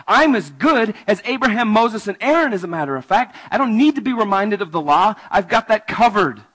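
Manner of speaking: loud, proud rich man bragging; a self-satisfied rich man boasting to Jesus; smug boast. Acted. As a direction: brag like you're untouchable. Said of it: "without even breaking a sweat".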